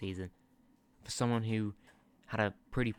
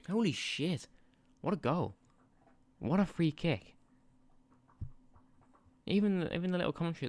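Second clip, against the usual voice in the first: higher, real delight at a great goal; amazed excitement; 8 semitones higher than usual. Real, not acted. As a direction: react to a stunning free kick.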